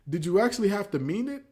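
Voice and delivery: usual speaking voice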